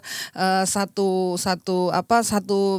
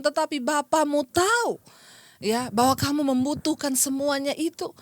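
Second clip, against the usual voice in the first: raised, urgent sermon voice; impassioned preaching; 6 semitones higher than usual. Real, not acted. Preacher urging the congregation to rely on God, impassioned preaching. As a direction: exhort with higher-pitched conviction. Exhorts congregation after testimony.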